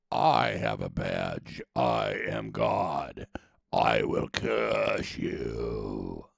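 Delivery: deep, slow brute booming with ego; barbarian. Retold or imitated. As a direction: boom it out like a power-mad brute. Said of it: "this is where that ego comes in".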